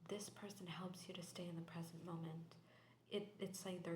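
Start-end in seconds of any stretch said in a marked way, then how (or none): 0.1-2.5 soft ASMR tarot whisper. Real, not acted. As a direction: breathe the reading out in a hush.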